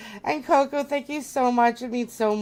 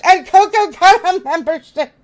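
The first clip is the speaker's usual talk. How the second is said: higher, louder excited squeal; 7 semitones higher, 9 dB louder than usual. Real, not acted. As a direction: burst out with delight at the gift. Squealing at new gifts.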